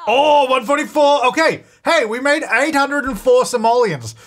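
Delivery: high-pitched delighted exclamation; elated, voice jumping up; thrilled celebration. Real, not acted. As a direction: burst out over a big sale. Celebrates a last big sale.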